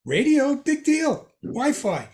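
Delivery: mock unimpressed kid. Modern kid dismisses radio.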